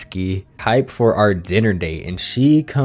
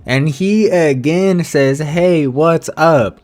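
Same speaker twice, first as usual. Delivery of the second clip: exasperated mockery; 6 semitones higher, 3 dB louder, slower than usual. Disbelief at repeated message.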